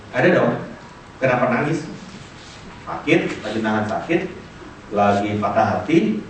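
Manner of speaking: ordinary delivery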